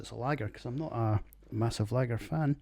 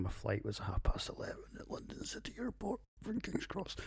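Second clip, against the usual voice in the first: whispering; 9 semitones higher, 8 dB quieter than usual. Real, not acted. Aside about a rushed morning.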